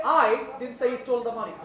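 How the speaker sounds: "I" stressed. Shown as a demonstration. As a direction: hit "I". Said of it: "Somebody else said it".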